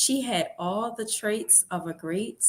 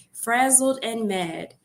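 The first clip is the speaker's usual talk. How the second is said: usual speaking voice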